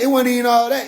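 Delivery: ordinary talk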